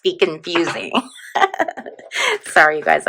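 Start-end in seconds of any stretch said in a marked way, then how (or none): 1.3-1.9 quick very high giggle. Real, not acted. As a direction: giggle again. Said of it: "I'm so giggly".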